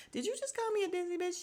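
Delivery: fast, high mock outrage; high-pitched affronted mimicry; imitating Drew. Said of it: "Drew is like".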